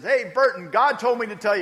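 high, loud call of "God told me to tell you"; a kid shouting his message at Burton. Retold or imitated. Kid calls out to Burton, retold by pastor, loud and high.

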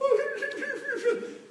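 a burst of high laughter; sudden high laugh. Real, not acted. Telling the couple's tears, a high laugh. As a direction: break into a high laugh mid-story. Crying at sad movie, laughs.

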